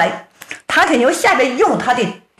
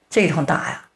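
ordinary delivery

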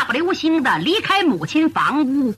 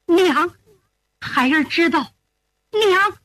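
ordinary delivery, nothing marked

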